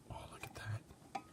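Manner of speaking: breathy unvoiced whisper of delight. Shown as a demonstration. Whispering to viewers over the craft table. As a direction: hush it like a secret.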